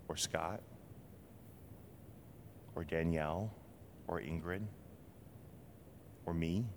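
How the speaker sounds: soft, fading sincere appeal; intimate hush. Real, not acted. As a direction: speak each name quietly, personally. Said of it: "naming listeners softly".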